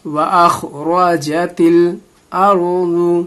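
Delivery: slowly chanted "الْأَرْضُ" with a heavy, full ض; thick zwaad. Shown as a demonstration. Teacher modelling the verse, slow chant with heavy ض in "الْأَرْضُ". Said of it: "دوات کی آواز کو موٹی رکھیں گے".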